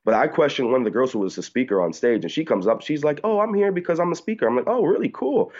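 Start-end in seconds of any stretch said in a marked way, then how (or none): 3.2-4.5 much higher mimicking voice; mock her in a high pitch; imitated female speaker. Recounting a stage speaker introducing herself, high mimicry. 4.7-5.5 very high, feigned interest; mock enthusiasm. Replying to the stage speaker, high mock enthusiasm. Acting own reply to the girl.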